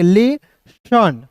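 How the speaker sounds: "लेसन" drawn out very slowly; slow pronunciation demo. Shown as a demonstration. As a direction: stretch "लेसन" syllable by syllable. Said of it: "इसे क्या पढ़ेंगे?". ("लेसन" बहुत धीरे-धीरे)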